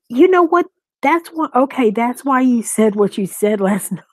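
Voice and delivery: it just clicked for you; half-laughing aha moment; excited realization. Real, not acted. Realizing why a chat troll acted.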